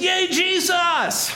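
high, long-drawn celebratory shout; crowd cheer. Acted. Synagogue crowd cheers Jesus for a moment, jubilant shout. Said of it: "'Cause then they stopped cheering".

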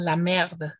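"la merde" with the D sounded out; "la merde", D clearly pronounced; clearer D. Shown as a demonstration. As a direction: stress the D in "la merde". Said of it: "con la D un poquito más pronunciada".

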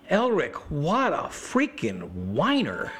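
slow, drawn-out mock disgust; theatrical exasperation; exasperated scorn. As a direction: heckle him, stretching each word high. Wife's scornful heckle, acted.

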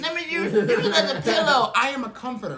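high-pitched, hammed-up mother coaxing someone into a hug; motherly comforter. Friend imitating castmate's motherly energy, hammed-up motherly coo. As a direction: gush like an over-loving mama, laughing a little. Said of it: "motherly energy about you".

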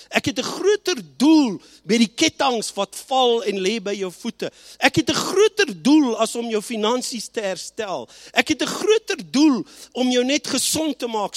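God's promise, preached with growing force; preaching at full force. Acted. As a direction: build each line into a declaration.

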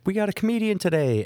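high, lightly put-on announcing voice; mock old-timer announcement. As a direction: announce the comedian like a cheery care-home host. Staff announcing to old folks.

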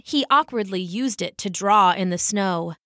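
usual speaking voice

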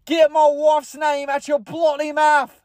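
high, slow, hammed-up British outburst; British accent. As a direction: stretch it into a very high mock-British yell. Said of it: "He would say bloody".

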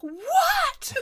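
very high, loud laughing yelp of disbelief; Joey hearing Beth dies, high loud mock shriek; shocked what. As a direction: shriek it like the news is unbearable. Joey reacts to Beth dying.

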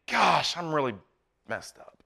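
quiet confession. Retold or imitated. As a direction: let it sink away into a confession. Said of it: "you're admitting".

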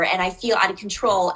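usual speaking voice

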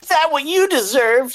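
put-on very high, loud taunting kid; child voice. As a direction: squeal it like a mean child. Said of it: "said one of the kids".

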